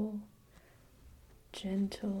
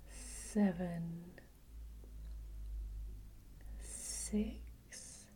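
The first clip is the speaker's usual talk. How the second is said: whispered slow countdown, long pauses; slow soothing countdown; 4 dB quieter, much slower than usual. Acted. Counting steps down in trance.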